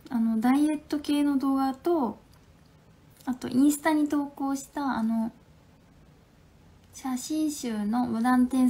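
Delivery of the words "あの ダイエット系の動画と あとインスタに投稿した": ordinary delivery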